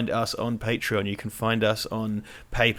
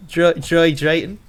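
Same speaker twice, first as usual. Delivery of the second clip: put-on Dry Drayton, slow, higher and louder in a Fenland accent; 6 semitones higher, 8 dB louder, slower than usual. Teasing co-host with Dry Drayton in a slow Fenland accent. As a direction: drawl Dry Drayton like a Fens local. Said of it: "doing this accent".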